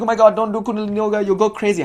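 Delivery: high, fast mock alarm; fussy high warning, tossed off fast; mock panicked critic. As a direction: squeal it like a worried skeptic. Mocking fearful critics.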